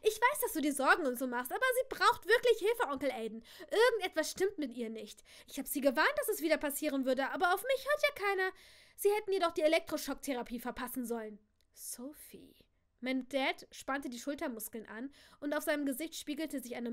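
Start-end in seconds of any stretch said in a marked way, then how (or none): 0.0-11.4 loud, urgent pleading that jumps high; agitated outburst. Shown as a demonstration. Frightened niece pleads with Uncle Aiden, agitated. 11.7-12.6 barely voiced, whispered name; hushed warning. Tense father torn by fear and anger, whispering.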